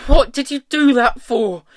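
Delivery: high, loud furious demand; loud, high-pitched accusing yell; angry shout. A narrator's reading. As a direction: shout it at him in outrage. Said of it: "she shouted".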